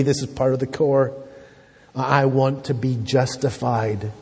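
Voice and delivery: ordinary talk, nothing marked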